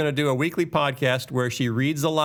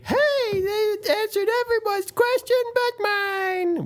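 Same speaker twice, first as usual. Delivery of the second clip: very high, drawn-out mock whine; hammed-up squeaky complaint, annoying on purpose; irritating complainer voice. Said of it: "say it in that voice".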